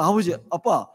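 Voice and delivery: indignant question, half-acted; imitating the son fuming on the phone; huffing indignation. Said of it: "화가 난 그런 상태가 좀 막 씩씩거리면서".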